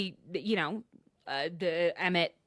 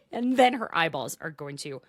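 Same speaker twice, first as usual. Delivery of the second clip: normal talking voice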